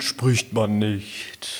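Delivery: talking with mouth full; slow, muffled, mouth full of food. Pretend. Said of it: "mit vollem Mund".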